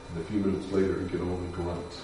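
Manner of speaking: normal talking voice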